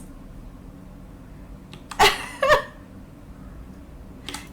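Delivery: high, breathless laughing fit; helpless laughter. Real, not acted. Streamer laughing at the absurd scene, helpless. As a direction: laugh till it turns to air.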